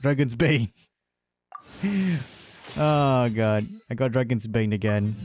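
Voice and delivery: ordinary talk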